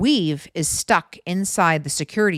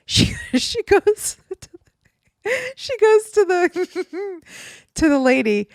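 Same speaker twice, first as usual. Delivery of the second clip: helpless giggles breaking up the words; laughing; 13 semitones higher, slower than usual. Real, not acted. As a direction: squeak it out through laughter, much higher. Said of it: "I don't know why I'm laughing".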